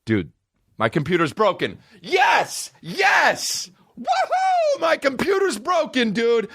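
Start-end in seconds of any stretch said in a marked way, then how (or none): 2.0-6.5 mock-triumphant yell, much higher; sarcastic cheer. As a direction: celebrate the disaster with a fake whoop. Sarcastic cheer at broken computer.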